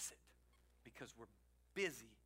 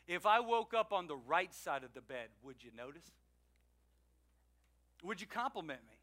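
ordinary delivery

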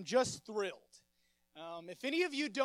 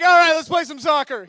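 laughing surrender; 6 semitones higher, 20 dB louder than usual. Retold or imitated. Giving in to friends, laughing.

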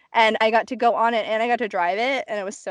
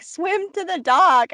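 higher, drawn-out call across water; imitated urging call; 7 semitones higher, slower than usual. Friend urging a panicking swimmer on, imitated calling. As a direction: call it out slowly, mimicking a friend on shore. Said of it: "my friend was looking at me panicking".

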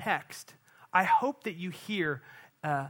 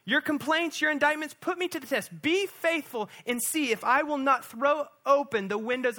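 higher, pressing challenge, each phrase pushed; bold divine dare; 7 semitones higher than usual. Retold or imitated. Preacher voicing God's dare to his people, bold. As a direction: dare them to test him. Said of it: "And God goes".

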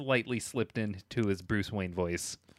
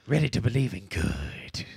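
lower, put-on silly movie voice; mock movie-speech voice, pitched down; silly impression voice; 4 semitones lower than usual. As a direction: drop into a silly hero impression. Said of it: "then you did your silly voice".

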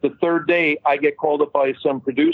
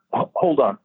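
throat-clearing interruption. Retold or imitated. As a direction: imitate a man stopping to clear his throat. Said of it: "he coughs a little bit".

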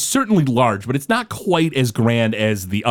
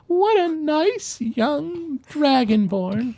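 high, very slow doting lady; an old lady cooing at a stranger; old woman voice; 13 semitones higher, much slower than usual. Acted. Old woman admiring the dragonborn, high slow doting voice. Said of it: "And she goes".

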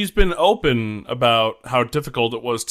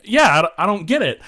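baffled shrug; 7 semitones higher, 4 dB louder, faster than usual. Retold or imitated. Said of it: "you're like".

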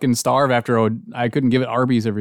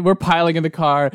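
ordinary delivery

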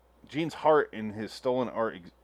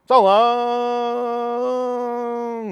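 high drawn-out sign-off; 12 semitones higher, 7 dB louder than usual. Acted.

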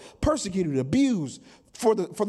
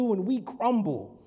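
normal talking voice

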